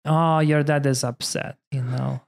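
ordinary talk, nothing marked

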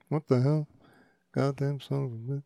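soft movie-quote mutter, fading away; muttering to oneself. Retold or imitated. Walking around the house hunting a pen, mock muttering. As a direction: grumble it quietly under your breath, as an imitation. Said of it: "I say that to myself constantly".

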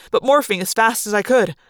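usual speaking voice

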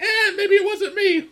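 high, laughing shrug-off of blame; dodging worker. Retold or imitated. Plant workers at the bottom dodging blame, high and laughing. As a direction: squeak it out, passing the buck. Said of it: "are like".

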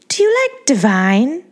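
put-on high, sweet baby-doll voice; Angeline's girly voice. A narrator's reading. To the narrator, a high, sweet starlet voice.

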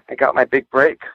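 usual speaking voice

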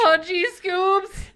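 high, slow cracking voice; Shaggy voice. Retold or imitated. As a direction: whine it like a scared stoner. Imitating Shaggy.

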